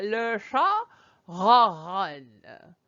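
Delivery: le chat ronronne as if a pen sits at the back of the mouth; mock muffled learner's ronronne; garbled R's with a pen in the mouth; pen-in-mouth attempt. Shown as a demonstration. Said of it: "if you need to stick a pen to the back of your mouth".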